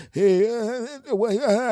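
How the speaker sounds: put-on high, stammering young kid; a kid too shocked to get words out; flustered stammer. Boyfriend catching them in bed, high flustered stammer.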